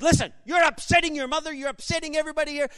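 imitating an irate doctor scolding; angry doctor. Doctor scolding the son, mock angry and fast. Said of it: "lost it. Like, he was really mad".

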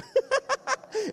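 high bursting laugh; a sudden high giggle at the memory. Real, not acted. Preacher laughing at her bold mom, bursting laugh.

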